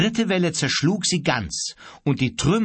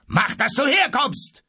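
high, angry captain's call; threatening shout; 12 semitones higher, 3 dB louder than usual. A narrator's reading. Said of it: "rief der Kapitän drohend". (drohend rufen)

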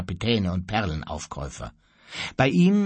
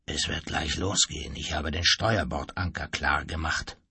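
low, hushed warning; subdued; 8 semitones lower, 5 dB quieter than usual. A narrator's reading. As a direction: warn softly in a low voice. Anxious mate reports the coming storm. (leise und tief)

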